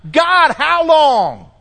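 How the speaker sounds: slow, high and loud cry to God; slow, loud lament; anguished plea. Retold or imitated. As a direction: draw out the plea heavenward. Preacher's own plea to God.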